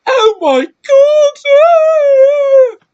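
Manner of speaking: hammed-up, very high, drawn-out wail; piercing fake scream of horror, stretched long; mock panicked shriek. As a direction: shriek like a squeamish actress fleeing blood. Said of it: "She'd be like".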